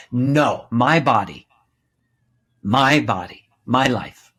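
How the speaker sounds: loud, emphatic declaration; firm, claiming no. Retold or imitated. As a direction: stake your claim in short, forceful bursts. Voices the 'no' he found.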